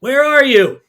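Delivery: loud, high call into the dark; shouting. A narrator's reading. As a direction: shout out searching. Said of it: "he shouts".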